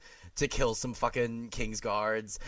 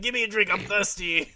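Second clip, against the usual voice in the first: loud, high boisterous shout; playful mock-lordly holler; rowdy demand; 7 semitones higher, 6 dB louder than usual. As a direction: call for a drink mid-battle, hammed up. Imagining the cackling lord mid-battle.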